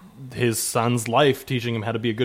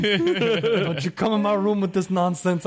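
higher, hammed-up indignant warning; mock scolding; 7 semitones higher, slower than usual. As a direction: shoo the wife away in mock outrage. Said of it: "I'm gonna tell my wife".

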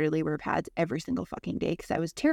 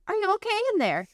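higher, mock-caring grandma call; calling through door. Nana calling through bathroom door.

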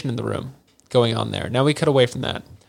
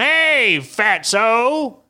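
high, drawn-out holler; trucker's mock yell; 10 semitones higher, much slower than usual. Trucker yelling out the window, high mock holler. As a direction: holler it as an irate truck driver. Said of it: "He's yelling out the window".

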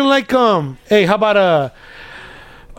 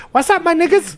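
lightly voiced, high-pitched English guy; high mock-British greeting; 11 semitones higher than usual. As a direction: pitch it up, playing a British lad.

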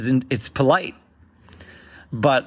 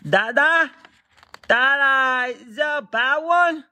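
put-on much higher child's voice, innocent; teasing toddler; 12 semitones higher than usual. Toddler to dad during diaper change, high and innocent. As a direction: ask like a sly little kid. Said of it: "asking you questions they already know".

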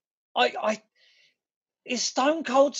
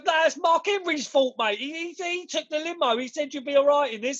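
higher cockney geezer voice, laughing through it; a driver shrugging off the blame; mock cockney driver; 6 semitones higher than usual. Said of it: "he goes".

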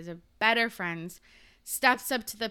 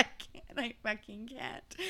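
whispered; 11 dB quieter than usual. Real, not acted. Laughing, whispered.

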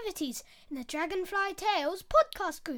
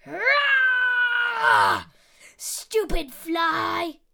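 loud, slow furious roar; the lion scratching his own nose, roaring in anger; 3 semitones higher, 9 dB louder, much slower than usual. Acted. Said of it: "Lion roared again".

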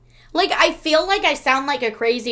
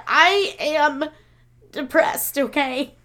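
retold exasperated retort, raised pitch; slightly louder, mock-defensive admission; defensive comeback; 4 semitones higher, 3 dB louder, slower than usual. Telling sister off about the messy house, defensive.

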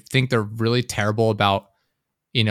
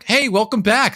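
mock cheery greeter; 11 semitones higher, 4 dB louder than usual. Mimicking timeshare people.

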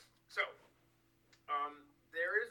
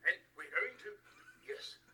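Boris Johnson impression; 5 semitones higher, 5 dB louder than usual. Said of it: "the way he talks".

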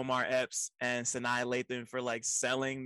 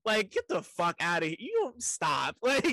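ordinary talk

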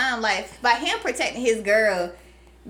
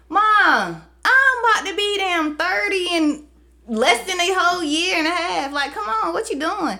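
higher, louder, pleading with mom; exasperated plea to mom; 6 semitones higher, 3 dB louder, slower than usual. Retold or imitated. Daughter pleading with her overprotective mom, exasperated. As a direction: come on, what you doing. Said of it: "pleading with her mom".

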